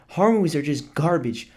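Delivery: normal talking voice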